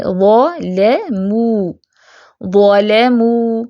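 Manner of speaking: ظلموا very slowly, thick ظ and light لام; heavy zaa. Shown as a demonstration. Teacher recites the example word slowly. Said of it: "کیسے پڑھیں گے؟".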